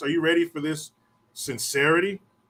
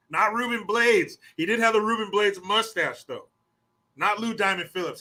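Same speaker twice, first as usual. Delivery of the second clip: ordinary delivery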